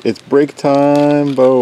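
slow, drawn-out boxing ring announcer. Shown as a demonstration. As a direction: stretch it out like a fight announcer. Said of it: "Ring announcer voice".

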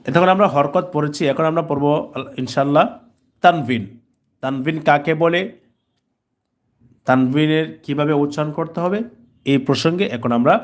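ordinary delivery

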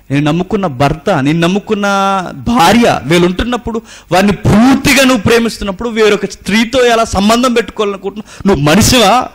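climbing from plain to loud, high accusation; building fiery rebuke. Acted. Rising rebuke. (క్రమంగా పెరిగే గట్టి మందలింపు)

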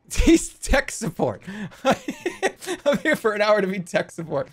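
high, slow talk through laughter; helpless real laughter stretching the words; cracking up. Real, not acted. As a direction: squeeze the words out while laughing. Host cracks up about helping.

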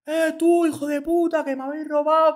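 high mock voice of the junkie at the door; angry junkie. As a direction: imitate a rowdy guy accusing.

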